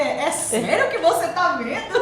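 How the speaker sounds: loud, high, laughing incredulity; mock disbelief. Woman retelling her reply to a prophecy, incredulous. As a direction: retell your astonishment through a laugh.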